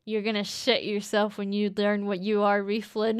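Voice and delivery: usual speaking voice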